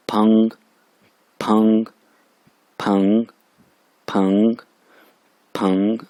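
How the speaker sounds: फंग as one syllable, gently rising; combined second-tone syllable. Shown as a demonstration. Teacher reading initial, final and tone together on फंग, rising tone. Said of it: "combine करके सारी tone भी साथ".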